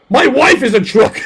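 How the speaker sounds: high, loud mock Goggins; Goggins impression. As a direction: shout it proudly.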